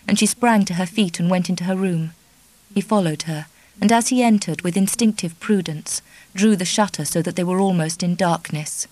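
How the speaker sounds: plain reading voice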